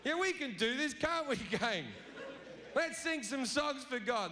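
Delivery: nervously chirpy crowd; nervous followers. Acted. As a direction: hurry to please God. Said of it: "And they're like".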